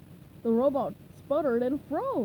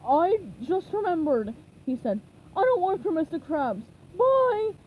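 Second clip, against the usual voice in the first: Patrick voice; 5 semitones higher, 5 dB louder than usual. A narrator's reading. Patrick flees cheerfully.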